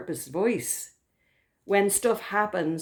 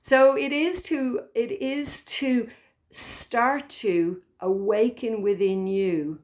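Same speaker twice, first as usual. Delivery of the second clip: normal talking voice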